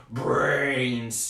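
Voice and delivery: slow groaning zombie moan. Shown as a demonstration. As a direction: drag the word out like a zombie. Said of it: "say in your best zombie voice".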